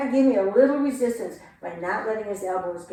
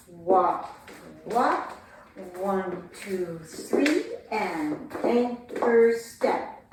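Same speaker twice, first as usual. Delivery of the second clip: anchor step said very slowly; drawn-out count; 2 semitones lower, much slower than usual. Shown as a demonstration. Teacher counting the sugar tuck slowly. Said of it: "counting dance steps".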